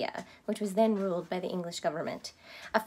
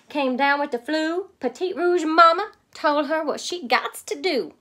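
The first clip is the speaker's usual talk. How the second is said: louder Cajun storyteller; Cajun accent; 5 semitones higher, 6 dB louder than usual. A narrator's reading. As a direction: Cajun narration, projected. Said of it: "my take on their accent".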